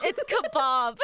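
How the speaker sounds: "kebab" with a broad AH; firm British kebab. Shown as a demonstration.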